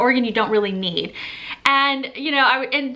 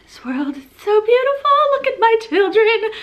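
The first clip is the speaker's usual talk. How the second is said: much higher, gushing mock rapture; gushing happy emotional; 10 semitones higher, 4 dB louder than usual. Vlogger imitating her hormonal happy tears to viewers, high gushing mock rapture. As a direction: swoon over the world and the kids like a sappy card. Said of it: "mostly it's been happy emotional, where I'm just like".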